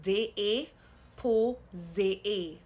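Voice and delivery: "D A po ze A" slow and loud, each ay drawn out; syllables broken out. Shown as a demonstration. Teacher asking learners to hear the A sound, slowly sounded out. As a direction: break it up as day-ay, po, zay-ay. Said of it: "do you hear that A sound".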